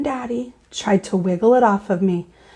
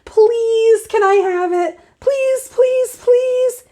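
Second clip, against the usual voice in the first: put-on high, pleading child's please; child begging Daddy for the swim ring; 10 semitones higher, slower than usual. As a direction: beg with hands folded. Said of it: "I folded my hands real begging".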